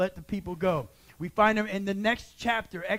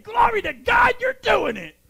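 high, loud joyful cry of praise; retold preacher's jubilant shout; excited shout; 11 semitones higher, 7 dB louder than usual. Pastor's excited reaction retold.

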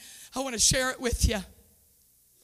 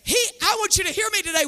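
higher, ringing preacher's exhortation; full-force preaching; 6 semitones higher than usual. Acted. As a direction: urge them to give it to the Lord.

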